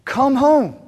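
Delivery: calling the Pharisees home; pleading. Acted.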